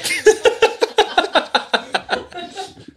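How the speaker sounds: long squealing laugh; high burst of laughter. Real, not acted. Teller cracking up at the gross story, laughing.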